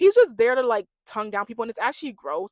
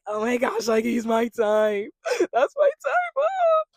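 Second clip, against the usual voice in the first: mock swooning; 5 dB louder, slower than usual. Contestant gushing over the newcomer, mock swooning. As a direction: imitate a smitten girl getting shriller and louder. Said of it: "Melanie's a bird, so she's over here being all like".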